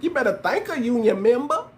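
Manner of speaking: high-pitched put-on voice, rising at the end; high mimicry of a politician's put-on talk; mocking Kamala. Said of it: "We don't even talk like that".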